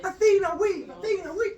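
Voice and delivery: high-pitched mock crowd chant; crowd cheering. Storyteller as crowd rooting for a weaver, mock cheering. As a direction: cheer for your favourite in a squeaky high voice. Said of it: "they were cheering back and forth".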